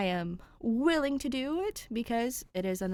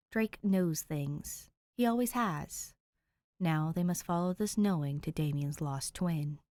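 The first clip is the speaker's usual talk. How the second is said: lower, even reading of a blurb; story summary read; 7 semitones lower than usual. Acted. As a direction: read the summary like a book jacket. Reads the fic summary aloud.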